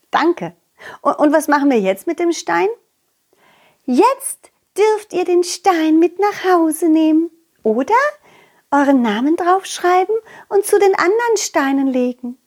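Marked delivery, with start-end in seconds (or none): 3.8-12.4 put-on butterfly girl voice. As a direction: kindly explain what they may do with the stone. Butterfly girl gives instructions.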